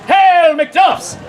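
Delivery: high, ringing proclamation; King's messenger. Acted. Messenger hailing the McDuffs, grand proclamation. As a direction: hail them high like a herald. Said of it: "I am the King's messenger".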